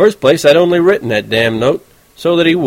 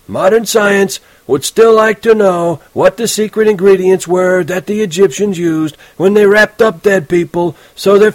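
pompous reading aloud; 9 semitones higher, 4 dB louder than usual. A narrator's reading. Teacher reading Holden's essay aloud, mocking. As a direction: read the boy's essay back to him with scorn.

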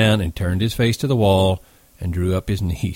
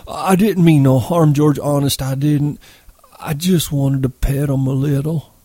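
put-on high, childlike begging; anxious, high-pitched appeal; pleading; 7 semitones higher than usual. A narrator's reading. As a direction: plead urgently in a simpleton's high voice, easing toward the end. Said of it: "held out his hands pleadingly".

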